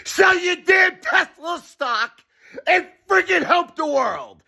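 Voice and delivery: very high, furious yell; exasperated shout. Real, not acted. Ordering the billionaire to sell stock and help, exasperated shout.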